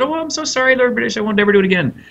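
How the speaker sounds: high, fast, simpering apology from a caught thief; hammed-up high whiny sorry; mock pleading thief. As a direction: squeak out a sorry like a cornered rogue. Thief apologising to Lord British.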